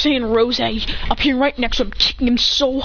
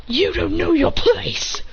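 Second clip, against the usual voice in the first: slow, high, crying-out villain line; villain taunt; 7 semitones higher, slower than usual. Acted. Keyblade fighter belittling Hit, slow high cry. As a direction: lord it over the beaten foe. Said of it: "It says".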